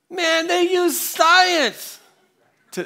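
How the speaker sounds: put-on high, slow, bellowing drunk voice; drunk guy shouting. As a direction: bark it out like a drunk who thinks he has won. Drunk man answers Kurt.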